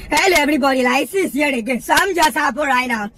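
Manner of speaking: ordinary delivery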